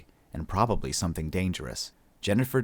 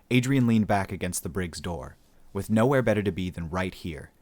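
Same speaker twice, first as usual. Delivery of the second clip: ordinary delivery, nothing marked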